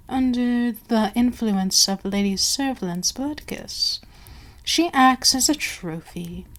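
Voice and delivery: normal talking voice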